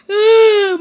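loud, high anguished wail; anguished cry. Acted. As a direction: cry out in mock agony. Season ends without postseason.

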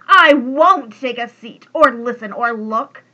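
loud defiant refusal that settles down; rave, then ease off by the last word; raving. A narrator's reading. Guard Bark refusing to sit with the logger, loud raving. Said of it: "the Guard Bark raved".

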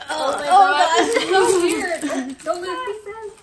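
loud, laughing alarm; frantic, laughing as it slips; laughing panic. Real, not acted. Puzzle flipped, about to break.

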